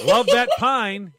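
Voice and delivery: slow, loud and high gushing; hammed-up polite delight; overdone enthusiasm. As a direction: gush it with drawn-out relish. Acting over-keen visitor in skit.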